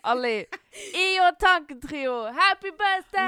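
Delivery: cheering. Real, not acted. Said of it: "toast cheer".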